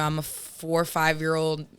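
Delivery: ordinary talk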